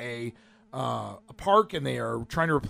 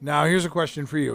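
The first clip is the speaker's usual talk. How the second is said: ordinary talk, nothing marked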